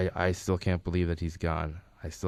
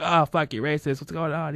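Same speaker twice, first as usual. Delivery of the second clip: higher-pitched teasing indignation; mock outrage; 9 semitones higher, 3 dB louder than usual. Husband ribs his wife over her remark, mock outrage. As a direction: imitate a friend ribbing his wife, pitched up.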